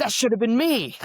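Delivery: high, loud, hammed-up protest; mock indignant outburst. Speaker voicing envy over another's graduation speech, mock indignant outburst. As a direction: play up the jealous outcry. Acting out envious thought at graduation.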